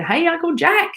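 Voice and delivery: high-pitched imitated street greeting; called-out greeting. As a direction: mimic passers-by hailing someone they admire. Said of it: "he just loves people saying".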